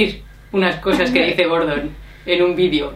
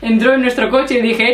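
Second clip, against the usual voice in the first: ordinary talk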